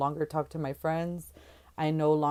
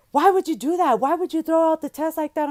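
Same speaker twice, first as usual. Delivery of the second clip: much higher, louder indignant questions; incredulous outrage; 12 semitones higher, 7 dB louder than usual. Retold or imitated. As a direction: demand to know why she did it. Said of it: "he's like".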